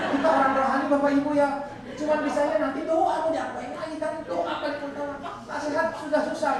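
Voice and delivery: hammed-up scolding, half joking; loud mock lecture. Preacher retelling his reply to the congregation, loud and mock-stern.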